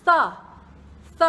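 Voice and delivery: th hissed with the tongue between the teeth; th sound alone. Shown as a demonstration. Teacher isolating the th sound for students, drawn out. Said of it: "เอาลิ้นเนี่ยอยู่ระหว่างฟันบนกับฟันล่าง ดูนะคะ".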